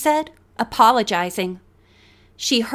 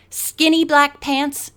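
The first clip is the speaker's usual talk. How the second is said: plain reading voice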